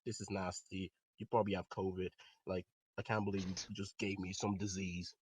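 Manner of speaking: low, subdued annoyance; pissed off. Retold or imitated. Telling friends of his disgust, quietly pissed off. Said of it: "now I'm pissed off".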